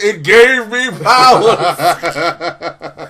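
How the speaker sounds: slow, loud, hammed-up proclamation; melodramatic villain boast. Villain boasts of his origin, slow mock-grand proclamation. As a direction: draw it out grandly, near laughing. Jason Praxis quote, hammed up.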